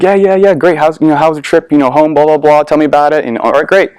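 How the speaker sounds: high, rattled-off retold chit-chat; breezy small talk. Mother making small talk with her son's friends, breezy and high. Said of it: "Small talk for a few minutes".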